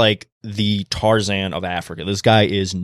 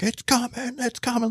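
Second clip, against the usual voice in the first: much higher, urgent cries of it's coming; panicked warning; 6 dB quieter than usual. Retold or imitated. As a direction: yell a warning while running from a charging hippo. Sprinting away, warning the others.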